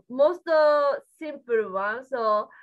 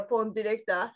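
normal talking voice

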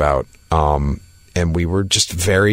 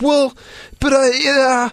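very high, squirming mock excuse; sheepish stammer; slower than usual. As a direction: imitate yourself caught out, squeaking and faltering. Cornered, stammers excuse to her.

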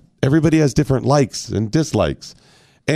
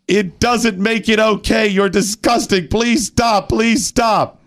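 sadness turning to anger; 9 semitones higher, slower than usual. Retold or imitated. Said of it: "My tears turned to anger".

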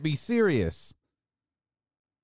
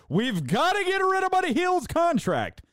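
high-pitched parody of overwrought fans; mocking fans. Imitating fans wanting a player's contract gone, mocking squeal. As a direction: squeal the demand in mockery. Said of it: "I love that when fans do that".

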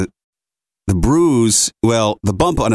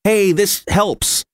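soothing; 9 semitones higher, much slower than usual. Retold or imitated. Soothing baby with ice.

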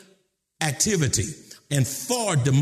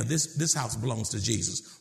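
ordinary talk, nothing marked

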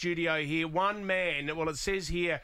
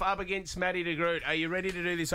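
ordinary talk, nothing marked

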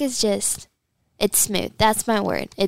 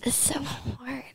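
breathy whisper of defeat; 11 dB quieter, slower than usual. Real, not acted. Guest stuck on a word game, whispering. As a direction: drop to a whisper, stumped.

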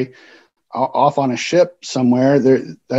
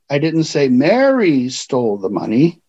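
"Mary" punched, the other words even; stress on MARY. Shown as a demonstration. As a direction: lean hard on "Mary". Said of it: "because of the way I emphasized a word".

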